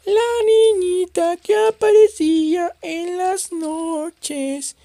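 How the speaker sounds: put-on very high, slow, eerie child's voice; sing-song it like a ghost girl luring you; creepy little girl. Gamer reading a haunting girl's song, eerie child voice. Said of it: "canta conmigo".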